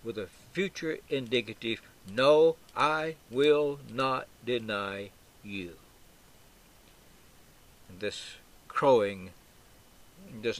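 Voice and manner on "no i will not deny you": strong "No", then slow, separated words; emphatic refusal. Acted. Peter's double negative to Jesus, emphatic refusal. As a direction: refuse word by word, deliberately. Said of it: "It's a double negative".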